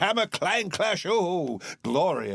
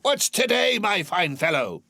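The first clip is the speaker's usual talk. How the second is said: calling Scrooge; 8 semitones higher, 4 dB louder than usual. Acted. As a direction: call down to the boy. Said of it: "said Scrooge".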